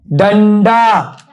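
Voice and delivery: डंडा stretched very slowly, falling; slow model word. Shown as a demonstration. Teacher models डंडा for the class, very slow. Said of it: "फिर बोलो".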